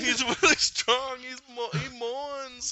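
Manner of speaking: high, loud, slow put-on voice; drawn-out squeal, r's softened; Mantis. Subduing Thanos in a trance, high straining imitation.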